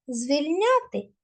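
usual speaking voice

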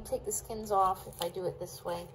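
ordinary delivery

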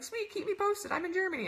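high-pitched, perky imitated reply; eager friend. European friend replying to coach, eager.

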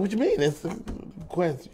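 puzzled, underwhelmed retelling; unexcited. Retold or imitated. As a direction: question it, then softly shrug it off. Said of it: "you don't sound excited enough".

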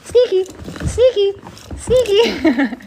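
high-pitched repeated trick cue; high, playful pet command; urging the dog. Real, not acted. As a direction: coax a dog along in a high, eager voice.

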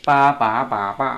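ปา ป๋า ป๋า ป้า through level, rising, dipping, falling tones; four-tone drill. Shown as a demonstration. Teacher repeating the drill for learners, four-tone drill.

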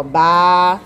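"ba" held long on an open A; "ba" drawn out for a pupil; sounding out A. Shown as a demonstration. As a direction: stretch "ba" to show the A sound. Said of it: "Tem o som do A".

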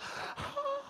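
high breathy gasp of delight. Acted. Flattered wife gasps at husband's compliment.

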